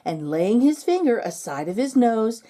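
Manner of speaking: rhythmic storybook recitation with music; poem reading. Acted. Narrator reading the Christmas poem to bookworms, rhythmic storytelling. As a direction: read it like a cozy Christmas tale.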